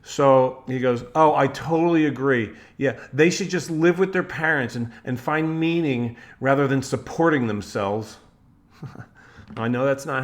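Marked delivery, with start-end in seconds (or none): 1.2-8.2 loud, mocking, sneering take on the post; sarcastic reading. Reading a friend's comment aloud as a loud sarcastic put-down. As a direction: read it like a dismissive old-school critic. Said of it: "I'm gonna read it in a way that he didn't write it".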